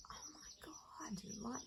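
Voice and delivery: stunned, slow whisper. Retold or imitated. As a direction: breathe it out in shock. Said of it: "I just sort of went".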